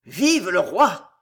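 slow, loud, held-in fury; concentrated rage. A narrator's reading. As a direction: spit it through suppressed rage. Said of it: "reprit le duc avec une rage concentrée".

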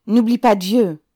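called-out warning. A narrator's reading. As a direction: call out a solemn reminder loudly. Crowd voice calls out a warning.

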